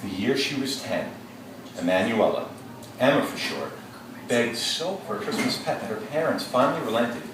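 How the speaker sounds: expressive classroom reading; story read-aloud. Acted. Teacher reads story aloud.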